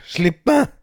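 high, loud desperate cry; girl shrieking as she pulls away; shouting. Acted. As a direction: scream to be let go. Girl struggling against mother.

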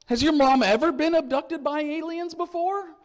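high, loud, drawn-up question; playfully disbelieving employee; incredulous questioning. Acted. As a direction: pitch it way up as a doubtful question to a child. Employee questions boy's alien claim.

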